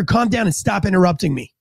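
normal talking voice

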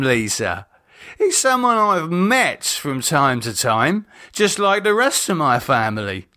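a lightly voiced bitter drunk man, sneering; David, bitter. A narrator's reading. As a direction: contempt leaking through a tired drawl. David bitter about family.

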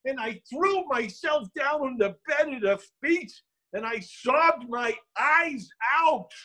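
high, wailing narration; overwrought grief. Acted. Storyteller recalling sobbing at his mother's feet, anguished.

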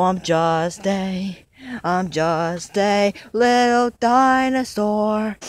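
slow, low, drawn-out longing; sad lament. Acted. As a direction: stretch each word like a lonely wish. Dino's lonely lament, long held.

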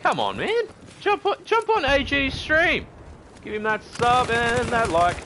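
high, loud, punchy yelling; hollering at a mate. Acted. Streamer acts ringing a friend to join stream, hollering. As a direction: shout down the phone to rope a friend in. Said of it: "just be like".

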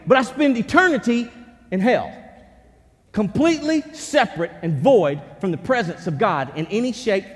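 loud, high, driving pulpit voice; full-force preaching. Acted. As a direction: preach it hard to the whole room.

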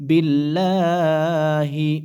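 drawn-out melodic tajweed chant; slow tilawah voice, held vowels; slow Quran recitation. Shown as a demonstration. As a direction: recite each syllable long and sung. Teacher recites the word.

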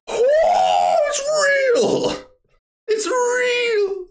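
shouting with joy, pitch jumping high; excited shout. Retold or imitated. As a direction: yell it like you just found it. Excited discovery of the show.

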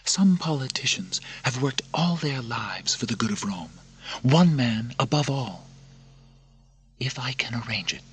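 high, half-whispered urging; conspiring princess. Acted. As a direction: offer the secret meeting. Narrator voices Lucilla.

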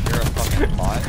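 loud insult shouted; raging gamer. Real, not acted. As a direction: spit the insult loudly. Trash talk in a video game.

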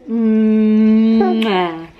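loud smacking kiss; long mwah kiss sound. Real, not acted.

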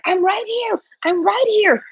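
high-pitched mock call for the ball; imitating an eager player. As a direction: lightly act out a player wanting a pass. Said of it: "He was like".

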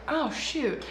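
ordinary talk, nothing marked

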